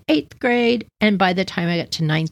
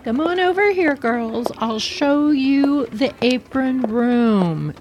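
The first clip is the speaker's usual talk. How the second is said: much higher sweet teacher voice; teacher calling; 5 semitones higher, slower than usual. Retold or imitated. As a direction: call the girls over like the tiny teacher. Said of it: "Missus Paris says".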